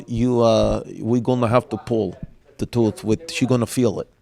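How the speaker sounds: put-on low Russian-accented voice. Dentist telling a father the tooth must come out, Russian accent. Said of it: "this Russian woman".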